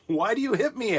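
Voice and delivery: a mild high plea from a beaten donkey; talking donkey. Acted. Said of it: "asked the donkey".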